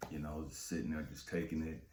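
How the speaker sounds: normal talking voice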